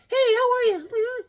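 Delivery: very high falsetto bride voice; mock-feminine falsetto hello; lovely bride. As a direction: squeak a greeting as the bride doll. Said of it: "here's his lovely bride".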